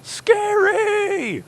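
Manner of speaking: very high, loud drawn-out squeal; hammed-up shriek of fright; playful scream, stretched out long; mock scared cry. Jokes about creepy low flying.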